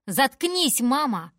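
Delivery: loud high cry at mother; furious shout. Acted. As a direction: scream it to shut her up. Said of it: "кричу я". (дочь кричит на мать: громко и высоко)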